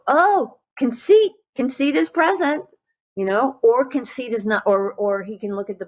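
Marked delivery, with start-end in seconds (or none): 0.1-2.6 much higher and louder, an amused realization; catching himself out. Retold or imitated. Monk noticing his conceit, high amused recognition. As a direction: spot the conceit with a bright little start. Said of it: "And then he'll go".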